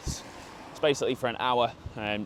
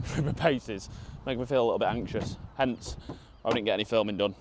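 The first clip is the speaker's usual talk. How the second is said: ordinary talk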